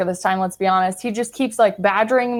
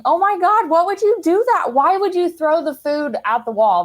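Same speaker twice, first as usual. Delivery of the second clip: high-pitched, incredulous questioning; mock laughing disbelief; 8 semitones higher than usual. Retelling how they challenged the drunk partner, mock laughing disbelief. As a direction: act out giddy disbelief, pitched way up. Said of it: "So I start laughing, going".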